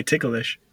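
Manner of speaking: "ticklish" stretched to tick-a-lish; three-syllable mispronunciation. Retold or imitated. Quoting what people say, "ticklish" as tick-a-lish. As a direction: put an extra syllable inside "ticklish". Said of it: "and not ticklish".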